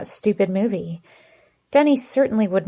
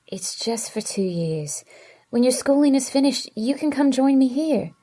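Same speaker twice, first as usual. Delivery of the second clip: whispered plea rising higher; begging; 5 semitones higher than usual. A narrator's reading. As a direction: boyfriend begging her on the phone, whisper to higher pleading. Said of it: "he begged".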